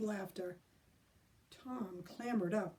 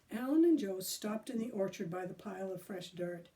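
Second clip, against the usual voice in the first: usual speaking voice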